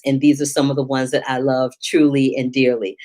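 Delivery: usual speaking voice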